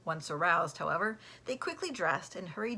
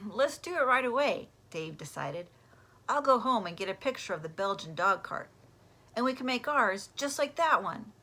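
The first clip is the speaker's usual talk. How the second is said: eager Dave; 4 semitones higher, 7 dB louder than usual. Acted.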